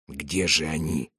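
low, softly voiced near-whisper; hushed whisper. A narrator's reading. Soldier to the comrade beside him in ambush, whispering low. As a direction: ask it under your breath, low and hushed. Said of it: "прошептал солдат слева".

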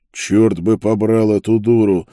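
loud, exasperated curse; angry cursing. A narrator's reading. Prisoner swears at the sight of Isolde, angry and loud. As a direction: swear forcefully at the fool. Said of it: "и выругался".

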